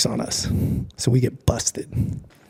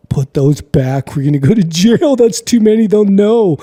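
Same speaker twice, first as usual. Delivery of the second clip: louder and higher, pleading in alarm; slow, urgent panic at first, then rising into a frantic plea; scared kid begging his friend to put them back, loud; panicked pleading; 7 semitones higher, 7 dB louder than usual. Retold or imitated. Panicking as friend grabs stack.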